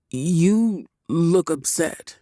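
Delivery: slow, gentle and careful. A narrator's reading. Dante carefully notices Cherry is upset, slow and gentle. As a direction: tread lightly. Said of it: "he said carefully".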